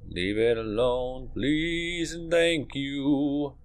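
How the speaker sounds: drawn-out, sing-song plea, slow; mock sweet pleading. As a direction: coax it sweetly like a pet. Pleading with game creature.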